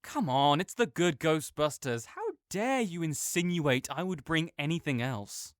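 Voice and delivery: ordinary talk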